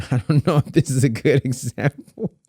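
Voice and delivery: laughing through the words; chuckling at your own example; amused, half-laughing aside. Real, not acted.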